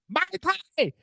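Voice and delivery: high-pitched mock drunken yell; retold screaming. Drinker recalls screaming drink orders at the bar, high mock scream. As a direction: squeal it out high, without full volume. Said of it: "screaming 'em".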